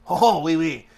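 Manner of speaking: hammed-up mock French accent. Shown as a demonstration. Showing friends a French accent, badly faked French. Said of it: "French accent, you know".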